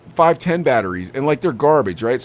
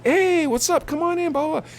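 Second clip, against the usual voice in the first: high, bright-pitched shop greeting, much higher; cheery greeting. Retold or imitated. Clerk greeting a walk-in customer, cheery and high.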